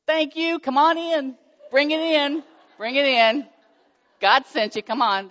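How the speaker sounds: high, loud, gushing welcome; excited welcome. Retold or imitated. Mom greeting donors at her door, high and loud gushing welcome. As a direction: throw the door open and sing out your thanks.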